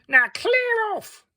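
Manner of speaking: very high, slow clear off; shrill dismissal. Acted. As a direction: shoo him away haughtily.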